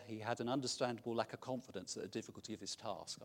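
ordinary talk

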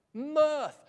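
Mirth said loud and falling, held out; emphatic mirth. Real, not acted. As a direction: lean on the word Mirth with relish. Preacher savouring the key word.

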